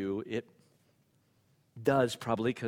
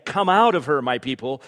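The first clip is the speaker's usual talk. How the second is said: voice from heaven; 3 semitones higher, 12 dB louder than usual. Acted. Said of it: "another voice from heaven saying".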